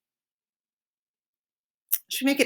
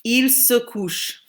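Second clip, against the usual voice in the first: teacher slows down; slow model; slower than usual. Shown as a demonstration. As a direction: say Il se couche slowly. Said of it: "he's going to bed".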